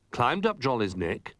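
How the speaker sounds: plain reading voice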